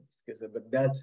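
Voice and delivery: ordinary talk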